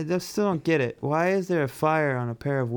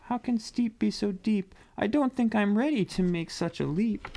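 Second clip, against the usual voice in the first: high little character voice; timid penguin voice; 8 semitones higher than usual. Acted. Perry looks down the slope, high timid penguin voice. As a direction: think aloud in a small, high voice.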